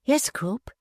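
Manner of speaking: a frightened wife answering; timid. A narrator's reading. Mrs Quilp to her husband, timid and meek. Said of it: "said the timid sufferer".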